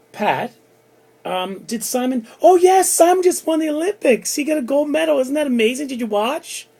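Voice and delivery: a proud aunt bursting to share the gold medal news; gushing stepmother. Retold or imitated.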